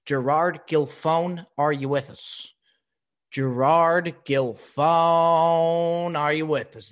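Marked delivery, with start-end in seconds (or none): 3.3-6.9 loud call, the name hugely stretched. Real, not acted. Host calling for his missing guest, loud and drawn out. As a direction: hold the last syllable like an announcer summoning someone.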